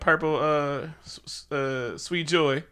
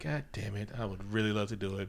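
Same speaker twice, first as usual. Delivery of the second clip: low grumble; 6 semitones lower, 13 dB quieter than usual. Acted. Reluctant hero sighs.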